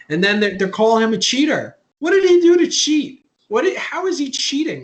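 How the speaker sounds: high, loud and exasperated; indignant rant. Real, not acted. Fed up with Yankee fans, loud indignant rant. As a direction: rant against the critics, voice up and loud.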